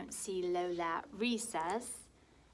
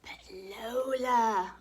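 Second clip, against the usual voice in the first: slow breathy whisper; 3 semitones higher, 9 dB louder, much slower than usual. Acted. Older brother whispering to Lola, slow whisper. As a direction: hush it, drawing out Lola.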